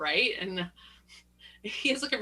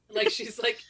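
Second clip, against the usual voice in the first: ordinary talk, nothing marked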